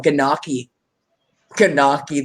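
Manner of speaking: "Ganaki" as guh-NOCK-ee, G pronounced; mock the misreading "Ganaki" with a hard G; sounded G. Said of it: "They say the G".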